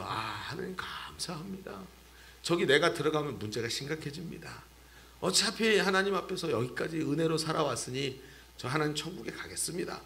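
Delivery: soft, hushed prayer of thanks; Moses at peace. Acted. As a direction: speak quietly, like a contented last farewell. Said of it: "얼마나 평안하게 모세가".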